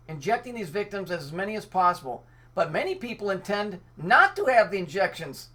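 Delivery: ordinary delivery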